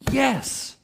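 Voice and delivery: yes lifted high and stressed; emphatic yes. Acted. Preacher answering the congregation with an emphatic yes. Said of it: "an emphatic".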